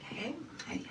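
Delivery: very soft, high whispery "hey"; hushed endearing call. Retold or imitated. Housemate showing an endearing exchange, whispered high and tiny. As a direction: call out tenderly under your breath.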